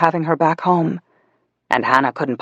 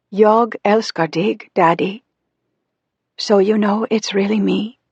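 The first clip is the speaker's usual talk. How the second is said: put-on high-pitched little girl's voice; small, fragile child; 5 semitones higher than usual. A narrator's reading. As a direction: speak as a fragile little girl, drawn out and high. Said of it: "her voice small and fragile".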